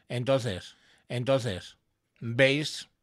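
each phrase repeated by a delay; delay echo. Shown as a demonstration. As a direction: talk through a delay that echoes every phrase. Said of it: "que básicamente repite todo lo que digo".

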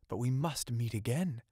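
usual speaking voice

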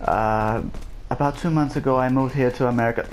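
slow, put-on British accent. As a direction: introduce yourself in a mock British accent. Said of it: "What do you guys think of my British impression".

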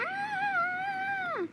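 high shriek of fake fright; pretend scream. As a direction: shriek as if bitten. Pretend creature attack.

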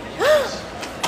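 sharp high gasp of delight. Real, not acted. Aunt spotting her baby niece, delighted gasp.

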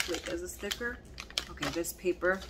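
ordinary talk

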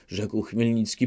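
plain reading voice

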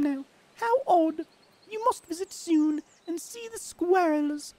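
British lady accent played for laughs, high and loud; British female accent. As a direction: read the letter as a posh British lady, hammed up. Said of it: "My voice is becoming weirder".

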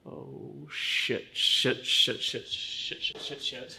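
panicked whisper. Acted.

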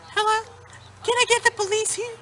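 high mock bus driver voice on the phone; squeaky mock driver calling police; imitating bus driver. Student mimics bus driver calling the police, high mock voice.

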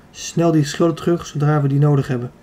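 usual speaking voice

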